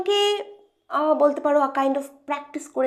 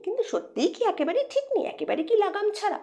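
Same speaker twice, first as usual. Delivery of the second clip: mock doubt; 10 semitones higher, 3 dB quieter, faster than usual. Mock question about moody y.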